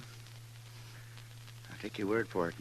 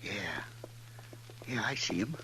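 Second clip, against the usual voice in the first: whisper; 6 dB quieter than usual. Acted. Hiding from outlaws.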